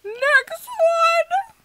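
high, loud announcer call; excited call. Real, not acted. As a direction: call out the next guest eagerly. Calls the next guest in.

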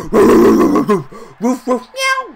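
playful dog bark then a meow; mock barking. Mocking the receivers as dogs with barking. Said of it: "they're dogs".

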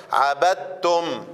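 عبدتم very slow, the م held with a moderate hum; drawn-out recitation. Shown as a demonstration. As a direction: model عبدتم for the students, slowly. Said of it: "التوسط بيجي قليلًا معايا". (ترتيل عبدتم ببطء مع توسط الميم)

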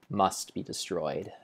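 ordinary talk, nothing marked

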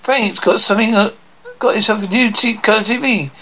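ordinary talk

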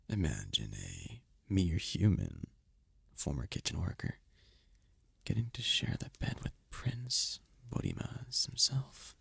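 soft, teasing whisper; seductive whisper. Acted. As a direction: breathe it into her ear. Teasing about sharing bed.

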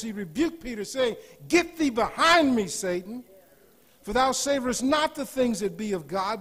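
loud, high, ringing scripture reading; preaching at full force. Acted. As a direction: thunder the scripture at the congregation.

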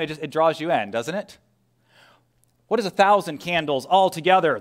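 ordinary talk

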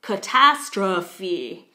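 "catastrophe" slow and loud, "uh" in first and third syllables; reduced vowels. Shown as a demonstration. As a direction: stretch out "catastrophe", punching "tas". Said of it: "it turns into a".

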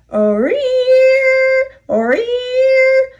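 long groan rising sharply high; creaking groan. A narrator's reading. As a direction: groan like freight cars straining to move. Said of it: "the cars groaned".

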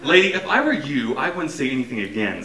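loud, high warning. Acted. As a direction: threaten her, loud. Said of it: "Sam warned".

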